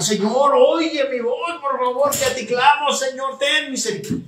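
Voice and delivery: very high, hammed-up wailing plea; crying out, pleading. Believer in danger begs the Lord, mock tearful pleading. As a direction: cry out desperately in a mock wail. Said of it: "Cuando tú estás chille".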